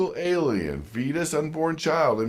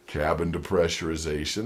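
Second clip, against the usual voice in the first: low, soft cabin depressurization; calm airline jargon; 11 semitones lower, 5 dB quieter than usual. Retold or imitated. Airline softening bad news, low and calm. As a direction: say it like a soothing announcer. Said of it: "airline euphemism voice".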